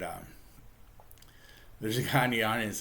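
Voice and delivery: usual speaking voice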